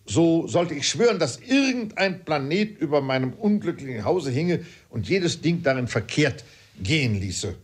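high, loud oath of a fuming father; worked-up father's tirade; exasperated father. Acted.

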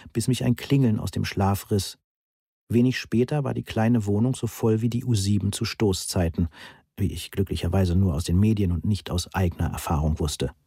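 ordinary delivery, nothing marked

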